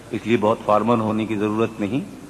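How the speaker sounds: normal talking voice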